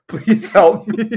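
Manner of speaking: slow, giggly mock plea; laughing plea. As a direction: beg for help while cracking up. Din voiced, laughing plea.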